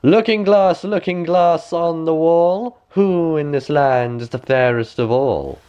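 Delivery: high, slow chant to the mirror; queen asking her mirror who is fairest; queen's incantation. A narrator's reading. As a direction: call on the mirror like a spell. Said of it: "went to her looking glass and said".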